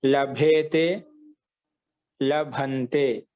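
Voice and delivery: slow, evenly spaced లభతే, లభేతే, లభంతే; slow dictation. Shown as a demonstration. Teacher repeating verb forms for students, slowly. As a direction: separate లభతే, లభేతే, లభంతే with held endings.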